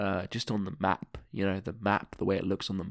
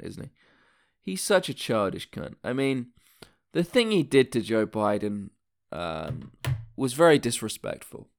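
ordinary delivery

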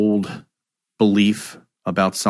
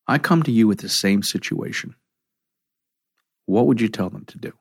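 calming coach; 3 semitones higher than usual. Acted. Coach guiding a triggered client to breathe, soothing.

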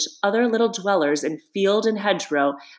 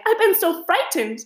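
high, shaky frightened mole voice. A narrator's reading. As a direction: still trembling from the dark wood. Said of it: "exhausted and still trembling".

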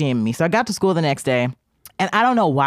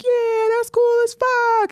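much higher, very slow, stretched-out cheer; hyped crowd; much slower than usual. Retold or imitated. Classmates cheering Tavia after the threat, high and stretched. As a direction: drag it out like a crowd hyping you. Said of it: "everybody was like".

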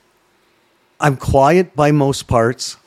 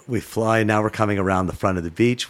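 ordinary delivery

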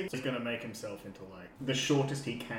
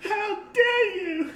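offended lady; 7 dB louder, much slower than usual. Acted. Disguised woman insulted by guard.

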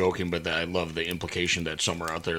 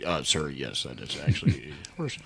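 meek reply; 6 semitones lower, 5 dB quieter than usual. Retold or imitated. Meek reply to the yelling director.

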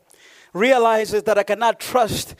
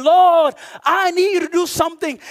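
loud, high preached plea to God; acted urgent prayer, leaning on "Lord"; crying out; 9 semitones higher, 5 dB louder than usual. Preacher voices mother begging God for help, crying out. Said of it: "she's crying out to God".

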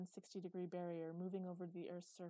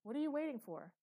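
raised, louder angry question; impatient demand; 6 semitones higher, 8 dB louder than usual. A narrator's reading. Uncle hurrying the frozen boy, impatient demand. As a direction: snap it as a threat.

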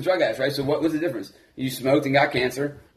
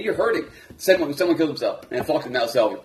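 normal talking voice

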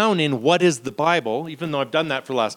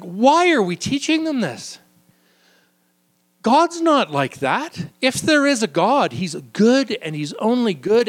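high, incredulous protest; a lightly acted indignant philosopher; indignant Plato; 7 semitones higher, 3 dB louder than usual. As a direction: scoff at the myths like an outraged teacher.

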